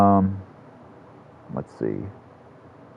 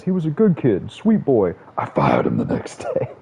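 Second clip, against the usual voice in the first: a hint of De Niro; 7 semitones higher than usual. Retelling the Casino voiceover to his viewers, lightly voiced De Niro deadpan. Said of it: "you hear the voiceover De Niro".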